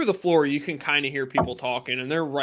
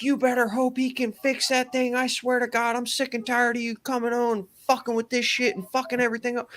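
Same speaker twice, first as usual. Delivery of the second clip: high-pitched, ranting mock wife voice; imitated furious wife; 10 semitones higher than usual. Wife going off on husband, imitated angry rant. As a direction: tear into him as the fed-up wife, pitched way up. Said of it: "I hear her just go off on this dude".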